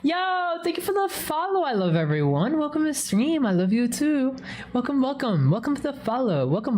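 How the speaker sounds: high, sing-out streamer thanks; real high-pitched welcome; excited greeting. Real, not acted. Greets new followers on stream.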